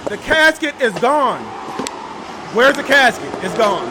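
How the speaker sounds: loud, high, gleeful disbelief; excited shout at the missing casket. Real, not acted. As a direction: yell it out as if you caught them. Excited discovery casket removed.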